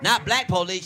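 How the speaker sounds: ordinary talk, nothing marked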